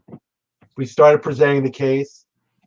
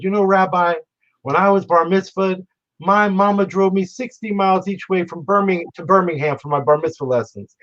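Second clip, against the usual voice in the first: put-on higher-pitched Alabama accent; imitated Southern judge; 6 semitones higher than usual. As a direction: play the folksy Southern judge reminiscing. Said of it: "spoke like he was twelve Southerners from Alabama".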